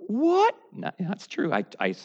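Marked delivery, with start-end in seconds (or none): 0.1-0.5 much higher startled what; a listener's imitated shock. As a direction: pop up in mock disbelief. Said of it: "And you're like".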